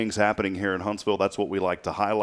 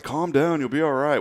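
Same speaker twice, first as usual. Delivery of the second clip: higher-pitched mock-wife voice; imitating reassuring wife; 8 semitones higher, 4 dB louder than usual. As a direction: play the wife waving away his panic. Acts out wife calming him.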